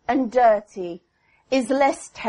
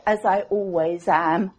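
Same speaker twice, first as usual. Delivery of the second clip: ordinary talk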